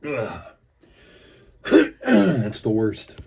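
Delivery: recovering from a choke; rough throat after choking; choking on cough drop. Real, not acted. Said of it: "Choking on my cough drop".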